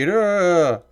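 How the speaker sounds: high-pitched rant mocking angry internet complainers; mock outrage. Streamer mimicking angry people online, mock-outraged whine. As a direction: imitate an indignant whiner, pitched well up. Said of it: "people being like".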